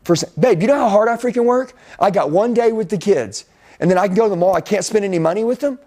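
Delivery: husband defending mall spending to wife, mock heated; defensive outburst. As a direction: snap back, mildly indignant. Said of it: "I, I, I said".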